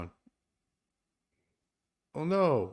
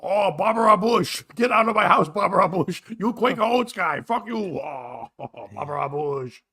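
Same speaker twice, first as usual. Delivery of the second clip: put-on confused old Bush; 4 semitones higher than usual. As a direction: rant like a muddled old father. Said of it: "Alzheimer's had his brain".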